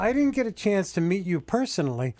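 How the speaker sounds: ordinary talk, nothing marked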